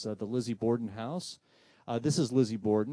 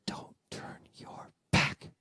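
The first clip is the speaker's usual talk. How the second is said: drawn-out whisper, word by word; slow ghostly whisper; much slower than usual. Retold or imitated. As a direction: whisper it very slowly into an ear. Ghostly voice returns, clearer.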